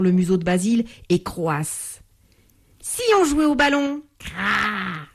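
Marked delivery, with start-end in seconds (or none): 2.8-4.0 high, teasing bird voice; lightly voiced playful heron. 4.2-5.1 imitated bird caw; heron croak.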